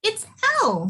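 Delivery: high little mouse voice; mouse character. Acted. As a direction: announce it as a tiny mouse. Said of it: "said the mouse".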